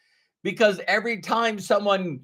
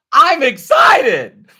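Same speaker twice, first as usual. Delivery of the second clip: loud, high, drawn-out excited shout; 9 semitones higher, 10 dB louder, much slower than usual. Shown as a demonstration. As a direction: burst out with over-the-top excitement. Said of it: "Or you could say".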